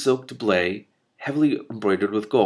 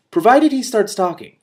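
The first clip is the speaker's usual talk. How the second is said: sharp loud threat; 13 semitones higher, 10 dB louder than usual. Acted. Witch threatening the prince.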